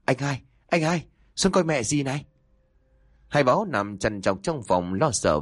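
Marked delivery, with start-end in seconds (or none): 0.0-2.2 high, frantic shout. A narrator's reading. Son yelling for his older brother, high and frantic. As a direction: yell for the brother upstairs. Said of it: "cậu vội la lớn".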